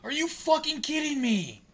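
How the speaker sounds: real high, loud yell at the game; outraged shout. Real, not acted. Fan erupting at the hockey game, outraged shout. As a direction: yell it in loud disbelief, pitch shooting way up.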